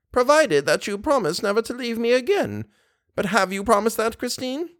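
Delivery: put-on high-pitched pleading old lady; anxious old mother. Old mother asks daughter never to leave, high and anxious. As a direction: press for a promise in a high old voice. Voices Mamma Valerius.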